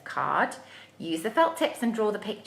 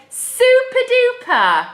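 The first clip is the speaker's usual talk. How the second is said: much higher and louder, slow; excited super duper; 12 semitones higher, 11 dB louder, slower than usual. Shown as a demonstration. Teacher modelling a cheer for grown-ups to repeat. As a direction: stretch it out with glee. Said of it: "Can you say".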